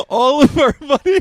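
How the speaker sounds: high, loud laughing squeal of the words. Real, not acted. Host cracking up about losing all their money. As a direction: crack up while you say it.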